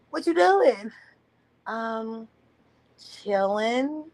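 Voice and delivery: mock dialogue. Acting phone exchange.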